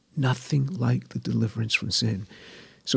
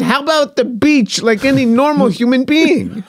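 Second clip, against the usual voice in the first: much higher and louder, a laughing exasperated mother; 13 semitones higher, 8 dB louder than usual. Retold or imitated. Mother exasperated at her son in church, high laughing scold. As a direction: play his mother scolding him with a laugh.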